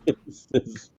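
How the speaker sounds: laughing. Acted. Laughing through a complaint.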